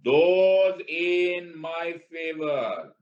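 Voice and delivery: slow, high, loud call to the House; mock Speaker. Acting Speaker calling vote. (Speaker की तरह ऊँची धीमी पुकार)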